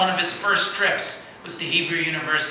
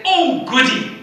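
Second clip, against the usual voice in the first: much higher, lightly British "Oh, goody."; a light hint of a delighted British aristocrat; posh delight; 10 semitones higher, 7 dB louder, slower than usual. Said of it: "speaks English with somewhat of a British inflection".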